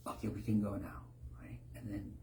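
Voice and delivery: breathy whispered plan, low voice; hushed whisper. Acted. Plotting soldiers whisper the plan in hiding, hushed. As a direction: whisper so the sleeping guys don't wake.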